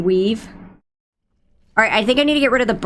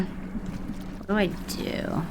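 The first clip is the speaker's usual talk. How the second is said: whisper; 3 semitones lower, 13 dB quieter, much slower than usual. Real, not acted. Panicked muttering to self.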